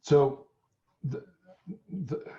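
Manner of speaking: talking around a lozenge in mouth; mouth full. Real, not acted. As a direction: mouth full of cough drop. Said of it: "I sound like I got my mouth full of marbles".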